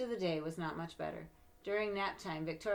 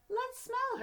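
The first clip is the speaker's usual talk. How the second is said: very high, sing-song taunt; mock her like a flower; bratty tease; 7 dB louder than usual. Classmate teasing.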